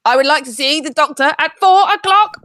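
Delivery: loud, high, irritated repeat to the machine; annoyed voice. Pretend. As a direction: snap it at the automated line. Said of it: "in an annoyed voice".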